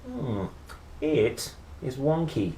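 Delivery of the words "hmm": ordinary delivery, nothing marked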